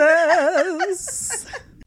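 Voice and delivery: loud burst of laughter; big laugh. Real, not acted. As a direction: crack up laughing. Laughs at own joke.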